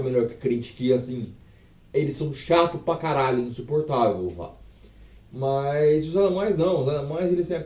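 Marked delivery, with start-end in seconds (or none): none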